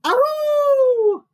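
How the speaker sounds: very high, loud howl; awful howl. A narrator's reading. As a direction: howl it long, falling at the end. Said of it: "and an awful".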